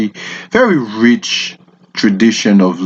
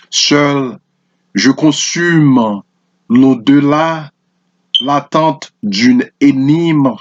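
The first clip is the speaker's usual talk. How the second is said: measured French poem reading with pauses between lines; poetry recitation; 4 semitones higher than usual. Acted. Presenter reading a Haitian poem to listeners, poetry recitation. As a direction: recite it line by line, letting each phrase stand.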